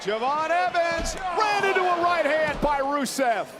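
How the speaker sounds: excited high play-by-play shout; wrestling commentator. Real, not acted. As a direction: call the big move, thrilled.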